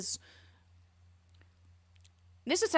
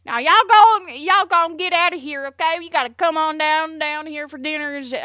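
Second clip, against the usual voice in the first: mock Southern accent; 7 semitones higher, 10 dB louder than usual. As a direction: play an over-the-top Southern host. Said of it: "southern draw".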